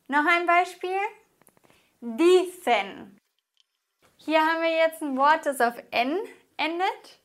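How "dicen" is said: Dicen in two slow syllables, DI louder, cen falling; penultimate stress. Shown as a demonstration. Teacher gives an example, Dicen stressed on the syllable Di. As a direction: hit DI in Dicen, then let cen drop. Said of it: "wird die vorletzte Silbe betont".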